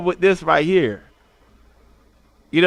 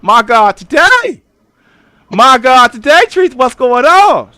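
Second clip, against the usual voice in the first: much higher and louder, hammed-up disbelief; mock exasperated outburst; 7 semitones higher, 8 dB louder than usual. Streamer roasting Tracy's photo in loud mock disbelief. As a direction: throw your hands up, loud and high.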